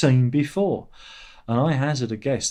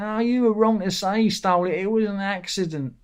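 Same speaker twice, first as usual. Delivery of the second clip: high whiny mock voice, slowed; mocking commenter; 7 semitones higher than usual. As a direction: whine like a fanboy defending his idol.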